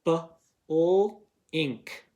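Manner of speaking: "B-O-ink" broken into separate sounds; sounding out. Shown as a demonstration. Teacher sounding out blinked for students, segmented. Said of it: "I hear a glued sound in there".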